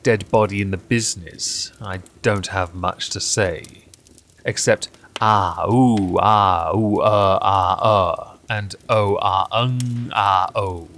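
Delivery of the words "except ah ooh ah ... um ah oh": theatrical moans of a dying extra; a string of overacted dying gasps and groans. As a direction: groan out a hammy death rattle. Extra acting out dying noises.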